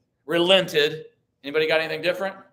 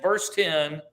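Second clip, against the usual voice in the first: ordinary talk, nothing marked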